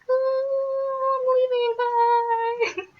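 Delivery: put-on very high, slow, stretched drunk farewell; a high, drawn-out boozy bye; tipsy sing-song goodbye. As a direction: float off like a tipsy guy leaving. Imitating the tipsy Wisconsin kid.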